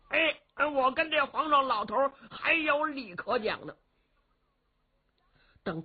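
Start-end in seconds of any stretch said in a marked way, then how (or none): 0.1-3.7 brash simpleton warrior. Acted. Young warrior cheekily addresses the old emperor, high and brash. Li Yuanba voiced by storyteller.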